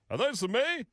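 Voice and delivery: high, playful question; joking. Retold or imitated. Middle-aged man kidding someone holding flowers, joking. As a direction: ask it teasingly, pitched right up. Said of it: "jokingly said to me".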